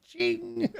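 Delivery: high, soft mock clink; tiny high-pitched ching; clinking glass sound. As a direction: make the glasses ring with your voice. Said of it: "ching pint glasses".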